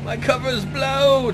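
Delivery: excited slow yell. Real, not acted. Player cries out as his cover is blown, slow and excited. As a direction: stretch it out in mock alarm. Cops spot him.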